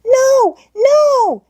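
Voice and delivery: put-on high, loud child's scream of no; the child shrieking to save her hat. A narrator's reading. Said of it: "screamed Heidi".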